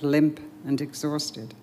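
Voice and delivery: ordinary delivery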